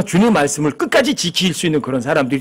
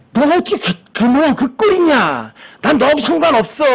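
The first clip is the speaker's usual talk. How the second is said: raised, high-pitched mock rebuke; scolding; 9 semitones higher than usual. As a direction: act out a dismissive telling-off. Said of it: "막 야단치시는 거예요".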